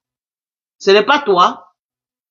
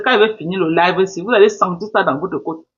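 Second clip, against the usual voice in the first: ordinary talk, nothing marked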